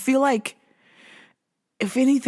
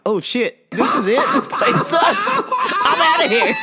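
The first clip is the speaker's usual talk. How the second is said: rising into a laughing shout, pitched up; imagined new hire; 9 semitones higher than usual. Pretend. New coworker realizing the job sucks, laughing mock outburst.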